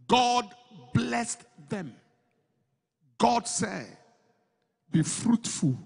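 shouting the blessing to the congregation; preaching at full force. Acted. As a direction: drive it home like a revival preacher.

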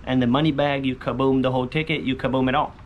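ordinary delivery

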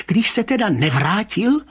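high, dogged pressing; stubbornly insistent. A narrator's reading. Rybka pressing the commissioner, high stubborn insistence. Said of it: "naléhal tvrdošíně pan Rybka".

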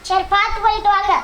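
ordinary talk

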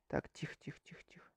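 hushed whisper; sneaking, keep it down. Real, not acted. Прячется от зомби. (шёпотом)

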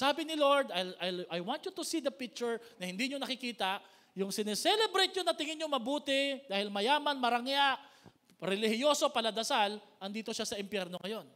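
loud, high, fervent preaching; preaching at full force. Acted. Preacher thundering the Lord's words to the congregation, fervent. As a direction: proclaim it like a sermon climax.

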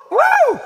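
very high, loud "woo"; exultant whoop. Real, not acted. Preacher after reading of newness of life, exultant whoop. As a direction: let out a shouted preacher's whoop.

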